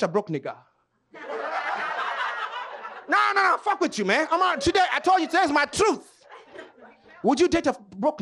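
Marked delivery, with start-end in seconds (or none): none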